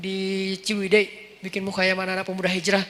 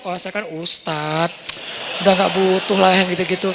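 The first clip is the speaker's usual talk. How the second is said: smug ustadz voice; 4 semitones lower than usual. Retold or imitated. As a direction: mimic a smug cleric brushing off help. (suara ustadz sombong, diejek)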